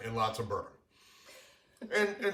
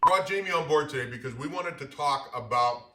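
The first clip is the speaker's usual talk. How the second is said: ordinary talk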